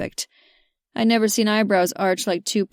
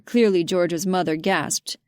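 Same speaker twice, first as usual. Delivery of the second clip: usual speaking voice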